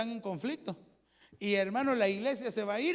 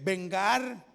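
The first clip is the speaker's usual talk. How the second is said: ordinary talk, nothing marked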